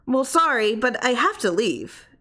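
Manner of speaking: breezy excuse on the way out; lightly voiced wife.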